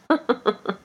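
short knowing laugh; dry weary chuckle; sardonic chuckle. A narrator's reading. Foot laughing at the woman, sardonic chuckle. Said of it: "sardonic, sarcastic, intelligent laugh".

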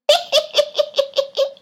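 high, loud sarcastic laugh; sardonic laugh. A narrator's reading. Feet laughing at the woman, sardonic high laugh. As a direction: laugh like you've seen too much. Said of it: "sardonic, sarcastic, intelligent laugh".

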